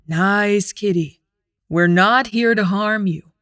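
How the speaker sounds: slow, soothing calm voice. A narrator's reading. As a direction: coax a wild beast gently. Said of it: "Aaron says calmly".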